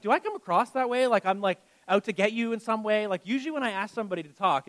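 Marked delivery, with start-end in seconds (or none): 0.0-3.1 louder, higher and wide-eyed; incredulous retelling. Retold or imitated. Pastor asks congregant if he seems threatening, mock-incredulous and louder. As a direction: pastor protests he's not out to get anyone, loud and incredulous.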